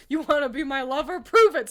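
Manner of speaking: fast, then a big loud shout on "Prove it!"; hammed-up dare, yelling it at him; mock seductive demand belted out; demanding challenge. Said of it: "that one Team Fortress two animation".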